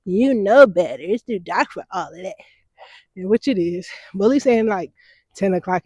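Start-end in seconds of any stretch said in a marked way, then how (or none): 0.1-2.4 imitating a scolding elder, loud on girl; mock-scold like the neighborhood aunties; a lightly voiced nagging elder telling her off. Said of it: "People gonna be on my head".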